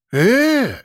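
high, drawn-out angry yell; roar. A narrator's reading. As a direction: cry out as if lunging at him. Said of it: "заревел он". (рёв, протяжно и высоко)

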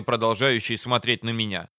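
ordinary delivery

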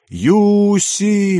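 long, high call of a name; drawn-out high holler; distant cry. A narrator's reading. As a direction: cry the name far across the forest. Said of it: "пронеслось вдалеке".

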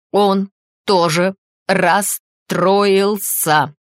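word by word, very slow and emphatic; учащённое дыхание. A narrator's reading. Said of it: "У неё участилось дыхание". (с усилием, по слогам, очень медленно)

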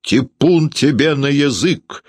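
high, slowed indignant cry; husband shouting back. A narrator's reading. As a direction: curse back at your wife, drawing it out. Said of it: "кричал Гудаевский".

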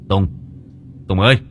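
loud, urgent call; Đã calling Tùng loudly. Acted. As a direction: yank him back by name. Grabs friend, calls.

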